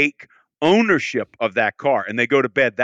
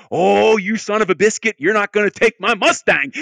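higher, louder mock indignant outburst; hammed-up fury at being outbid; mock outrage; 8 semitones higher, 5 dB louder than usual. Bidder outbid on his Mustang, hammed-up angry outburst. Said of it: "You're like, mad".

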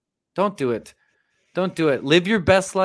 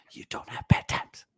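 whispered; 6 dB quieter than usual. Real, not acted. Voice drops to whisper.

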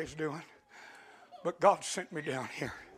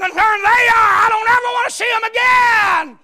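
angry shout; 16 dB louder than usual. Acted. Preacher voicing a brother who never wants to see them again, angry shout. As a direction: shout it out, higher and louder.

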